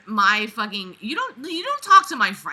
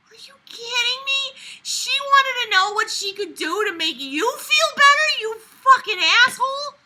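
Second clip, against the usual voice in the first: high, loud disbelieving rant; furious at the character; outraged rant; 13 semitones higher, 5 dB louder than usual. Real, not acted.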